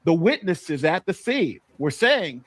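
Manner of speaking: usual speaking voice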